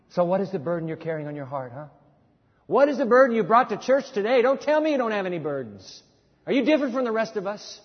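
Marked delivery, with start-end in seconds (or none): none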